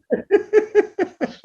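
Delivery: a burst of high laughter. Real, not acted. Laughing at the therapist's hostile dig, high laugh. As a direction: laugh at the absurd quote.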